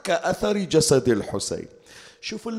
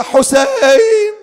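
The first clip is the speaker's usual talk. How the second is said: very high, loud, long-held shout; calling at full voice; 11 dB louder, much slower than usual. A narrator's reading. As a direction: cry out the name at the top of your lungs. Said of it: "ينادي بأعلى صوته".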